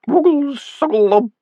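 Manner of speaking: tiny, very high gurgle; a waterlogged imp burbling; watery imp voice. A narrator's reading. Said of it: "watery sound".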